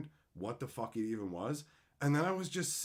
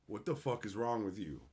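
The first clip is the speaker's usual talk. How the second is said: ordinary talk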